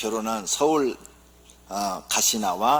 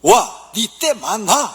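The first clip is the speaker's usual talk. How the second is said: higher, louder dialect jab; gruff Gyeongsang husband; 9 semitones higher, 9 dB louder, slower than usual. Acted. Gyeongsang husband mocking wife, loud dialect. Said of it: "경상도 남편".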